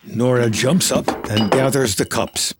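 ordinary talk

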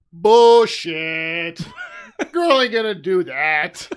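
loud scoffing dad voice; dad's indignant rant. Retold or imitated. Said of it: "he's like".

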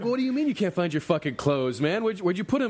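can't believe what he hears; exasperated disbelief. Retold or imitated. Calling out to naked friend on beach, incredulous. Narrator's exasperated retold shout.

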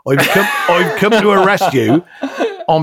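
lightly hammed-up Wiltshire accent, laughing through it; mock rural Wiltshire accent. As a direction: play the detective as a broad Wiltshire copper. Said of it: "the detective was from Wiltshire".

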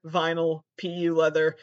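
usual speaking voice

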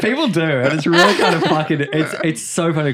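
talking through laughter; amused protest with laughs breaking in; laughing. Real, not acted. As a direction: laugh while insisting. Laughing as friend doubts her.

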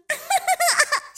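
short giggle; laugh. Acted. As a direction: giggle happily. Happy after declaring love. (chicotit scurt)

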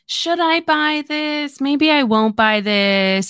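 slow, long-stretched pondering; drawn out. Acted. Lissa goads Remy into buying cutlery, drawn out. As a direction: drag every word out to goad her. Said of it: "it's very long, and it's very drawn out".

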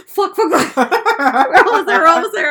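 laughing through loud panicked swearing. Retold or imitated. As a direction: blurt it out while cracking up. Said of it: "they would have just heard us go".

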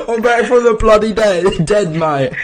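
loud, high "Crikey mate"; hammed-up slang shout; mock Aussie Jesus. Friend voices Dave Christ returning, loud mock accent. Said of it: "he's like".

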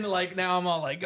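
ordinary delivery

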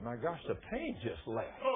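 low, soft, slow disbelief; hushed amazement. Retold or imitated. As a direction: say it quietly, stunned by relief. Healed man's hushed amazement, retold.

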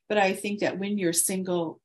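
ordinary talk, nothing marked

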